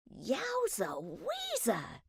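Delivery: high, slow, hushed yowza; a quiet marveling exclamation; gleeful private wonder, held soft; amazed thought. A narrator's reading. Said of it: "Ned thought".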